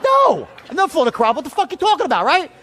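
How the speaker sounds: high-pitched indignant retort; agitated. Retold or imitated. Customer fed up with the agent's flying questions, agitated. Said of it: "I'm starting to get a little agitated".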